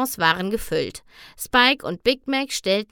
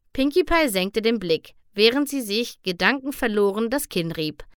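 ordinary delivery

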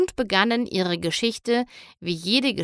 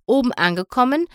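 ordinary delivery

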